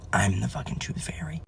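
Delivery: hushed confession; whisper. Retold or imitated. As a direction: breathe it like a secret. Confessing to daughter.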